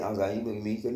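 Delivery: ordinary talk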